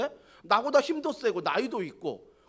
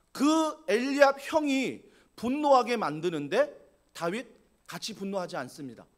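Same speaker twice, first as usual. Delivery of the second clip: ordinary talk, nothing marked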